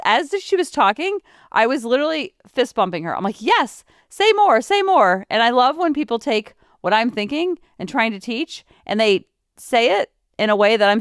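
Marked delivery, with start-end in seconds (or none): 3.4-5.2 eager agreement. Retold or imitated. Cheering on a speaker she agrees with.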